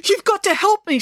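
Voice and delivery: high-pitched, panting plea; acted breathless panic, very high; desperate cry. A narrator's reading. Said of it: "she cried".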